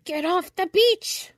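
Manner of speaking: high, slow scratchy order; scratchy-voiced crab, high and cross; cross, scratchy shout. A narrator's reading. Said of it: "a cross, scratchy voice shouted at them".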